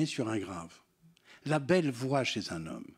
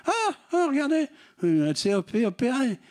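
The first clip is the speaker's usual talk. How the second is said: high, louder mock exclamation; jeering prisoners; 12 semitones higher, 4 dB louder than usual. Prisoners notice the lawyer's surgery, high mock jeering. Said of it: "tous les prisonniers l'adoraient".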